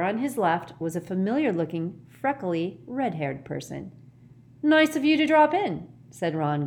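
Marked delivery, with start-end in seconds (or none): 4.6-5.9 grinning. A narrator's reading.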